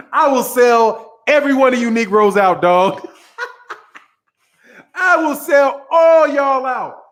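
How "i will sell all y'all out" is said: laughing shout. Real, not acted. Laughing hyperbolic declaration.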